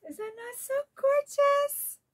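breathless awe at a gift; gasp of delight. Real, not acted. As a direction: gasp and gush over the pretty wrapping. Gasping at wrapped box.

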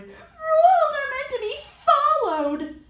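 shouting. Acted. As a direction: yell it to stop her. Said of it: "Ian shouted".

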